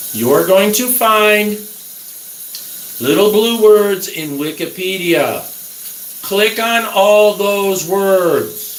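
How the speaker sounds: slow, deliberate, each word pressed; slow emphatic instructions. Real, not acted. Urging viewers to research, slow and emphatic. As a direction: spell it out slowly so they get it. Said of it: "The last thing I'm gonna say".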